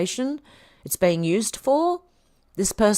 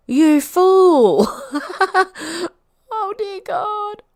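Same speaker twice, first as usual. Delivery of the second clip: laughing; 13 semitones higher, 7 dB louder, much slower than usual. Real, not acted. Laughing at a photo mix-up.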